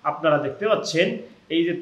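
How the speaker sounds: ordinary talk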